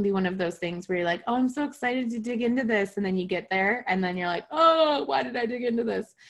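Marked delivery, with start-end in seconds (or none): 1.3-3.0 pitched higher, imitating eager enthusiasm; mock eager. Teacher imitating a student about to start shadow work, mock eager voice. 4.5-6.1 hammed-up regret, like a student who wishes they hadn't started; mock dismay. Teacher imitating a student regretting shadow work, mock dismayed groan.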